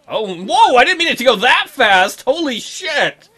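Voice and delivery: loud, high, stunned exclamation; whoa, caught totally off guard; startled outburst. Real, not acted. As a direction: blurt it out in amused shock. Startled by sudden in-game kiss.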